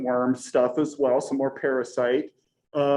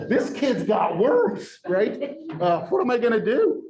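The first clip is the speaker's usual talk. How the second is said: freaking out; 7 semitones higher than usual. Retold or imitated. Worried dad quoting his own panic over worms, freaking out. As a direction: play a dad alarmed that his son has worms. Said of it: "like, freaking out".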